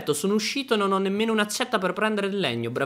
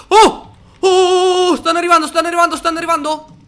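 high, loud panicked screams, fast at the end; terrified shouting; 12 semitones higher, 10 dB louder than usual. Real, not acted. Gamer shrieking as monsters close in, high panicked screams. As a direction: scream in real panic as they swarm. Mobs swarming the player.

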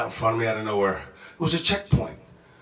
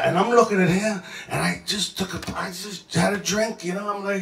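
ordinary talk, nothing marked

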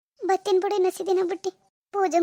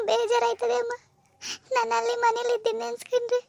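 higher, teary voice on the edge of crying; tearful; 3 semitones higher than usual. Acted. Daughter telling her mother she misses home, tearful. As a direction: pour out regret to her mother, near tears. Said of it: "ಹಿಂಗೆಲ್ಲ ಅಳ್ಬೇಡ".

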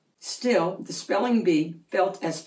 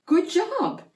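forced congratulation; 11 semitones higher than usual. A narrator's reading.

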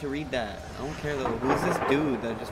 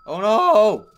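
loud frightened cry; startled shriek; scared yelp; 11 semitones higher, 18 dB louder than usual. Real, not acted. Jump scare.

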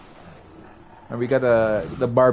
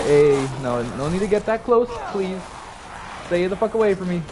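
ordinary talk, nothing marked

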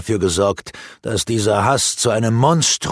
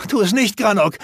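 much higher, louder pleading call; urgent shout; 12 semitones higher, 3 dB louder than usual. Acted. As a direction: shout to stop him attacking. Alana calling Granok off.

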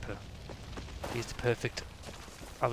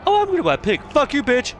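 much higher, louder mock girly sneer; mocking girl voice; 12 semitones higher, 7 dB louder than usual. Streamer mocks the girl in the pink t-shirt, high and sneering. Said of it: "She's like".

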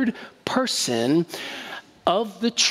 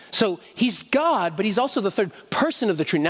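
ordinary talk

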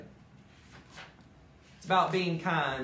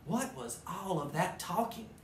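plain reading voice

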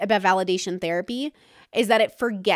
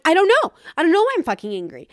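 higher, louder, fast angry snap; frustrated outburst; 7 semitones higher, 5 dB louder, faster than usual. Retold or imitated. Answering someone asking why she's angry, loud and fast. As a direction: blurt it out in exasperation. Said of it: "And then I'm like".

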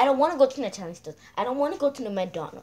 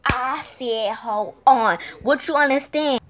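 exasperated; 8 dB louder, slower than usual. Acted. Insisting the other girl wait.

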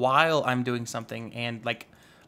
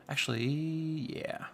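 whispered; 5 dB quieter, slower than usual. Real, not acted. Deciding quietly.

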